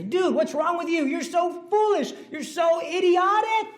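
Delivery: exasperated teacher yelling, rising; mock scolding shout. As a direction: scold the kid in a hammed-up rant. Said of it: "And I'd say".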